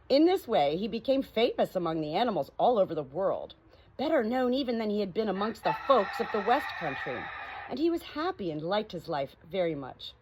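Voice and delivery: usual speaking voice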